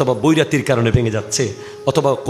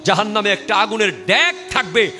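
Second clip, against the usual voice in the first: high-pitched, impassioned warning; fiery preaching; 9 semitones higher than usual. Real, not acted. Preacher warning disobedient wives of hellfire, impassioned high-pitched preaching. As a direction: thunder the warning to the congregation.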